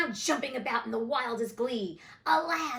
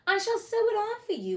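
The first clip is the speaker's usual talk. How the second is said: superior; 11 semitones higher than usual. A narrator's reading. Wendy exulting over Peter.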